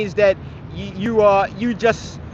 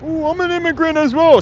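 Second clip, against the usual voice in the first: high mocking put-on voice of the commenter; mocking whiny voice; 8 semitones higher, 3 dB louder than usual. Streamer mocking a chat commenter, high mocking whine.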